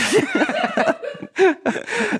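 squealing burst of laughter; high squealing laugh. Real, not acted. Guest finally guessed.

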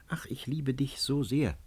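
plain reading voice

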